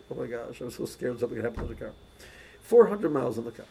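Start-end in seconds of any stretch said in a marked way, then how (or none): 0.1-1.9 nervous, hushed worry. Retold or imitated. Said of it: "It was like".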